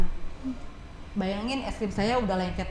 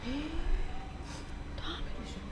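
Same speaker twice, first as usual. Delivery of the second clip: whispered, much softer warning; soft whispered Japanese scolding; quiet Japanese reproach; 7 semitones higher, 10 dB quieter than usual. Retold or imitated. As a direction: hush a queue-jumper under your breath. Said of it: "Dan tuh ngomongnya pelan".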